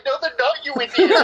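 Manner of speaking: scolding him through a laugh; mock exasperated retort. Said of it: "And she's like".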